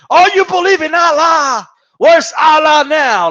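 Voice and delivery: imitating a commentator's over-the-top rant; mocking Foreman's rant. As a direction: rail at him like a ranting commentator. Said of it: "But I just remember".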